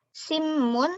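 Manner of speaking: simun said as simmun; Korean n to m. Shown as a demonstration. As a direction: let the n of simun turn to m. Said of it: "dibaca seolah-olah sama-sama M". (simun dibaca seperti simmun)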